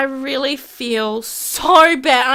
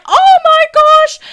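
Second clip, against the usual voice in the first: shocked woman; 9 dB louder than usual. Retold or imitated. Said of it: "And she's like".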